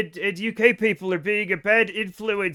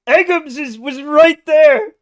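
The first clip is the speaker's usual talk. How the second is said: higher, loud and slow through laughter; laughing; 6 semitones higher, 8 dB louder, slower than usual. Real, not acted. Pointing out the obvious joke to chat, laughing. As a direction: drag it out while still laughing.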